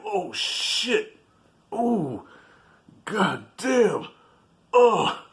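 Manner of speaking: high groaning after the blow; hammed-up winded groans; mock pain. Sly reacting to getting hit.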